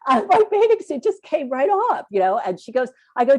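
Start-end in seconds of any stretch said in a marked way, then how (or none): 0.1-2.1 high, laughing-edged excuse; embarrassed excuse. Retold or imitated. Swimmer excusing herself to the lifeguard, high and embarrassed. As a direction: make the excuse, half mortified. Retold apology again.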